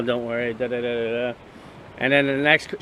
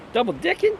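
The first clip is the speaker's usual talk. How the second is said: disbelieving high-pitched echo; shocked question; 12 semitones higher than usual. Shown as a demonstration. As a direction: Double dicking? squeaked very high, rising hard.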